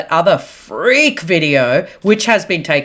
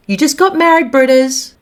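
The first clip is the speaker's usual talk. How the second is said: teasing; 9 semitones higher, 3 dB louder than usual. Real, not acted. Teasing address to Britney.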